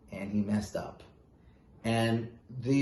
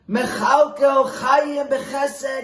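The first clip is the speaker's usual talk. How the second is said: much higher, louder and slow, a pleading cry; emotional scream; 12 semitones higher, 7 dB louder, much slower than usual. Retold or imitated. Rebbe pleading with God for his starving people, screaming. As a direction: cry it out to heaven with everything you have. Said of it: "he would scream on top of his lungs with great emotion".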